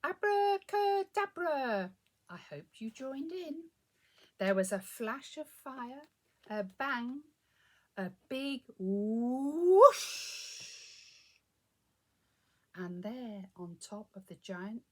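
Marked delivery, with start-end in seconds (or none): 0.0-1.9 slow, high, loud incantation; called-out spell. A narrator's reading. Casting the spell with listeners. 8.8-10.5 loud, rising, drawn-out whoosh; big magic whoosh. A narrator's reading. Magic noise in story.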